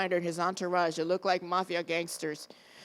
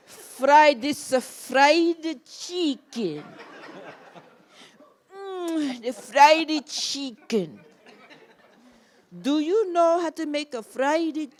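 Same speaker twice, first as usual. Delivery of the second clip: put-on slow, high, louder Italian accent; 6 semitones higher, 4 dB louder, much slower than usual. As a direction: savour fried chicken in Italian English. Fellini says fried chicken, slow.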